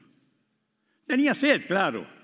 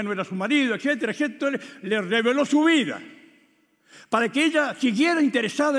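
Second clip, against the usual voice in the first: higher, louder impassioned sermon; full-force preaching; 8 semitones higher, 6 dB louder than usual. Acted. Preacher explaining Jesus revealed her life, impassioned. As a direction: press the point home.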